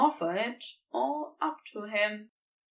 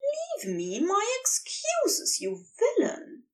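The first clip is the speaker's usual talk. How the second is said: high mock-offended Aziraphale; complaining Aziraphale; 10 semitones higher than usual. A narrator's reading. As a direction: grumble at him like a fussy angel. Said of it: "Aziraphale complains".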